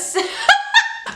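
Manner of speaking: squealing burst of laughter; high laugh. Real, not acted. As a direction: break into a high-pitched laugh. Laughs at own joke.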